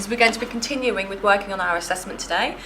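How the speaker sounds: high, projected teacher voice; addressing the class. Real, not acted. Teacher opening lesson to class.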